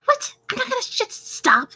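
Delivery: high, protesting in-character voice; annoyed retort. Acted. Sulking curser answering Pierre, high annoyed retort. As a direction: refuse in a high voice, breaking off. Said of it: "She's like".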